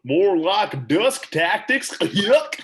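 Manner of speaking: very slow, drawn-out cartoon voice; Goofy. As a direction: imitate a goofy cartoon dog, stretched out. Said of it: "maybe, like, Goofy".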